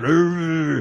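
mock tug-of-war growl; tug growl sound. Shown as a demonstration. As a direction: growl like tugging a rope. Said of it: "you gotta make the sound".

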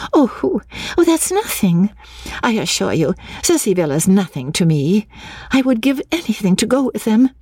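high-pitched, dismissive then eager heroine; eager young woman's high voice; enthusiastic young woman. Acted. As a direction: brush it off high, then plead keenly.

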